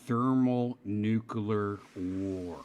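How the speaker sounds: slow, low-key dramatic intoning; movie quote voice. Acted. User naming a game from War Games, slow, soft intoning. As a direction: draw out each word softly.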